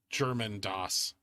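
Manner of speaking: Das said slowly, German style, low. Shown as a demonstration. Echoing chat's German Das, slowly. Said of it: "So you're saying it's Adidas".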